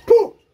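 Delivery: a sharp forceful puff of breath; loud ritual spit; ritual spitting puff. Real, not acted. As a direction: blow out hard like spitting on the spirit. Healer spits ritual breath.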